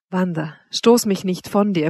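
ordinary delivery, nothing marked

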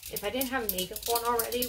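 ordinary delivery

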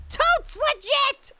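high, very slow, stretched slang; milk each word for emphasis; drawn-out emphatic repeat. Real, not acted. Player repeats the verdict to viewers, slow and emphatic.

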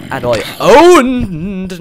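triumphant boast. Real, not acted. Gamer bragging about rugby, slow and loud. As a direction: stretch out the boast.

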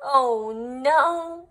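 plain reading voice

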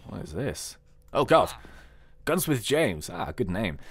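usual speaking voice

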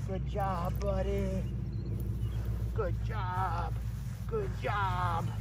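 low, soft praise. Real, not acted. Trainer praising puppy quietly, low and soft. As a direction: murmur praise to the pup.

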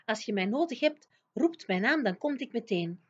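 plain reading voice

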